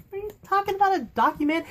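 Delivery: high, disbelieving squeak; incredulous. Real, not acted. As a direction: react as if the request is absurd. Incredulous reaction to Camille's request.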